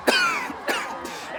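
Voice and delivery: high, strangled coughing; choking cough. Acted. Puppet hanging from the neck rope, choking. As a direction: choke as if hung by the neck.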